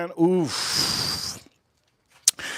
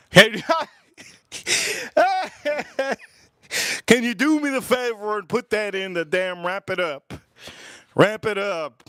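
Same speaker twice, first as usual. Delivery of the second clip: laughing through the retold request; half-crying with laughter, a bit higher; 5 semitones higher than usual. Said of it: "I'm laughing so hard".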